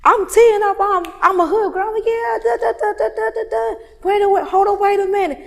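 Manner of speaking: high, loud party chatter; animated, high-pitched hype; hyped-up club talk. Retold or imitated. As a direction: act out getting rowdy on the dance floor. Acts out hyped club moment.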